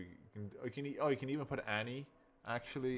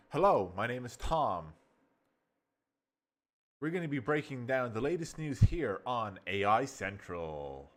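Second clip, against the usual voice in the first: upbeat newscaster intro; excited; 6 dB louder than usual. Shown as a demonstration. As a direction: lift it with enthusiasm. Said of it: "See that? Exciting. You're excited".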